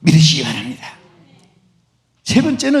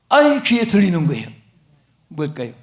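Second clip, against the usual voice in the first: normal talking voice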